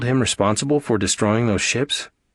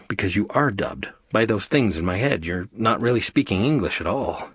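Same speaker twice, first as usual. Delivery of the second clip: usual speaking voice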